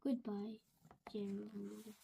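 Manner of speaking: faint sad goodbye; fading goodbye. Acted. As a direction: fade out the goodbye. Sad farewell after sneeze.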